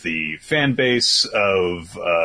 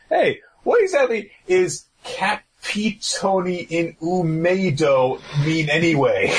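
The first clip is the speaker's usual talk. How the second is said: confused Soma; 7 semitones higher than usual. Retold or imitated. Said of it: "which is like".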